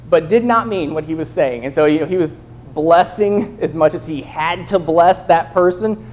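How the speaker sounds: ordinary delivery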